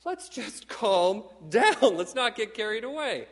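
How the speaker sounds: high, slowed mock villain voice pleading for calm; hammed-up sci-fi villains urging calm; mock Darth Vader and Emperor. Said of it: "Darth Vader and the evil Emperor Palpatine going".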